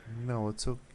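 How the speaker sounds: ordinary delivery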